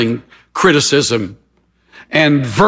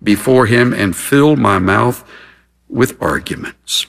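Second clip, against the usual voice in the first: low, measured reading with weighted pauses; solemn scripture reading; 7 semitones lower than usual. Real, not acted. Preacher reading a sufferer's words of faith to listeners, solemnly. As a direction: read it gravely, pausing after each phrase.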